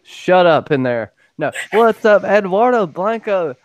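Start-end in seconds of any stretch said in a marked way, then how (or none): 1.7-3.5 loud, high shout-out to a chat viewer; hyped greeting. Real, not acted. Streamer hyping up a chat viewer, loud and high. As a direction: call out the name like a hype man.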